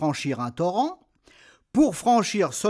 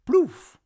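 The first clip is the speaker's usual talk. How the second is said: loud high splash cry; splash sound; 11 semitones higher, 5 dB louder than usual. Acted. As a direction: shout the splash. Troll splashes into water.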